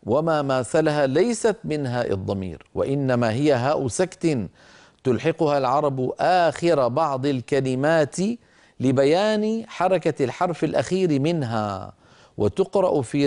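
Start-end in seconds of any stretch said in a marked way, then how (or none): none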